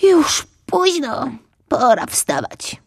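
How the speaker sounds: drowsy little cub talking to himself; little fox thinking. Acted. Fox cub deciding to get up, sleepy high voice. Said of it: "pomyślał".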